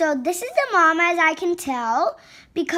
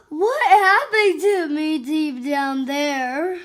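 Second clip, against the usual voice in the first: slow, wailing mock distress; freaked out; 3 dB louder than usual. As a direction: play a bewildered cat just pulled out. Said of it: "She's freaked out".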